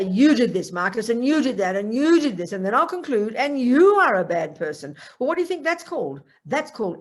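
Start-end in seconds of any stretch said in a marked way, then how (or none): 0.0-2.5 louder, accusing jabs at each "you"; accusing Marcus, louder and hammering each "you"; mad at Marcus. Retold or imitated. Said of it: "if I'm mad at Marcus". 3.4-5.0 louder, accusing jabs at each "you"; accusing Marcus, louder and hammering each "you"; mad at Marcus. Retold or imitated. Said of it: "if I'm mad at Marcus".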